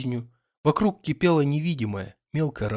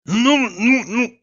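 louder, much higher threatening bellow; thundering; 10 semitones higher, 4 dB louder, much slower than usual. Acted. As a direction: boom it out at her. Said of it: "загремел он".

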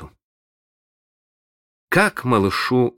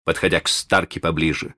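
plain reading voice

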